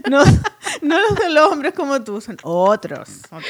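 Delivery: laughing while reassuring. Real, not acted. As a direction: giggle through it.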